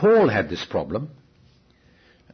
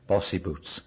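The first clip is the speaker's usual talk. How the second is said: ordinary delivery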